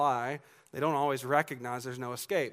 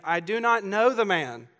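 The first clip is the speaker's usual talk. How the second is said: louder, higher, every word firm; emphatic denial; 5 semitones higher, 7 dB louder than usual. Retold or imitated. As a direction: deny it outright, as if on oath. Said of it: "he denied it with an oath".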